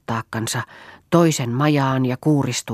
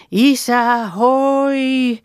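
high, ringing long-held call; 10 semitones higher, 5 dB louder, much slower than usual. Acted. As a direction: shout for someone out of earshot, stretching the last word. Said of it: "Huudetaan vaan".

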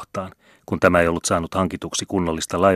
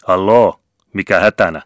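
louder, booming hail from the shore; powerful call; 7 dB louder than usual. A narrator's reading. Vicar calling from the shore to the band, loud hail. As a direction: call out loud across the beach. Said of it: "kirkkoherran voimakas ääni".

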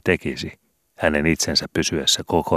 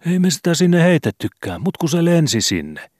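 put-on a much higher, meek young boy's voice; modest boy; 13 semitones higher than usual. Skobe answers the landlady meekly, as a timid boy.

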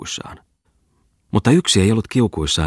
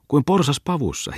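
"kuin porsas pavussa" thrown back much higher, mocking; mocking echo; 10 semitones higher than usual. Muttinen echoes Bungman's words back, high and mocking.

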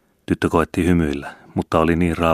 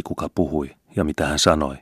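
ordinary delivery

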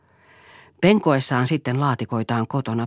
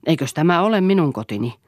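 heated retort, higher and louder; indignant husband snapping back; 5 semitones higher, 5 dB louder than usual. Acted. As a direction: fire back at her indignantly. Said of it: "kiivasteli Eero".

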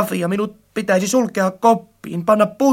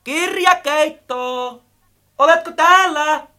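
calling out; 6 semitones higher than usual. A narrator's reading. Friend calls the missing book in the library, ringingly. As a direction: call across the shelves. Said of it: "Möttönen kajautteli jo".

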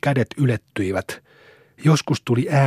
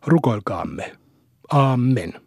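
solemn prayer; slower than usual. A narrator's reading. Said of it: "lausui juhlallisesti".

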